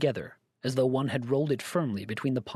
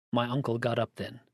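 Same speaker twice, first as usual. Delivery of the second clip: plain reading voice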